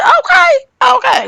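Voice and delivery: high-pitched shouted okay; high excited shout. Retold or imitated. Retold reply, shouted high.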